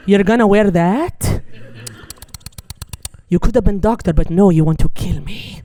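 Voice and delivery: loud, disapproving mom; judgmental mother impression. Mother criticizing her daughter in a nightmare, loud mock scolding.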